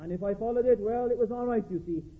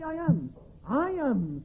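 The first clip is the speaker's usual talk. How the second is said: "I am" pitched up and set apart; stress on I AM; 4 semitones higher, much slower than usual. Shown as a demonstration. Preacher showing the emphasis in all the sayings of Jesus, stress on "I am". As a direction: ring out "I am" as the weighty words. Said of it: "that's the emphasis in all the".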